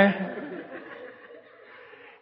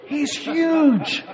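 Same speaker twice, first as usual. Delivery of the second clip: ordinary delivery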